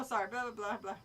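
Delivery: plain reading voice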